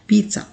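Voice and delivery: पिज़्ज़ा as "peet-sa" with a ts sound; correct pronunciation. Shown as a demonstration. Teacher repeating पिज़्ज़ा as peet-sa. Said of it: "बहुत ही आसान करके मैंने आपको बता दिया".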